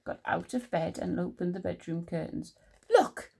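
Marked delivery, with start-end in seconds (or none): 2.9-3.3 high, loud, emphatic word; Big Mouse pointing out. A narrator's reading. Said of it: "he said".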